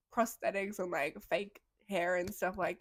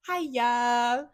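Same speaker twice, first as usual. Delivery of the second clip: British "Hiya", a touch higher and louder, rising at the end; imitated British greeting; 4 semitones higher, 9 dB louder than usual. Imitating the British coworker picking up the phone, with a British "Hiya". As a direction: answer the phone like a cheery Brit. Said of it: "he goes".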